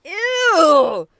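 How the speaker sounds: loud, high disgust. Real, not acted. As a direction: squeal it in disgust. Disgusted reaction to gross detail.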